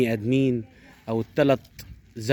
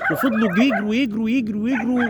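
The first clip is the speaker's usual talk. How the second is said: higher and higher, building thrill; excited race narration; 9 semitones higher than usual. A narrator's reading. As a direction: chant the running like a race call.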